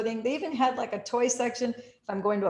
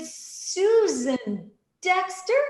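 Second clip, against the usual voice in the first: much higher, slow amazed question; surprised; 11 semitones higher, 6 dB louder, much slower than usual. Retold or imitated. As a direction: call out her name in surprise. Surprised recognizing friend.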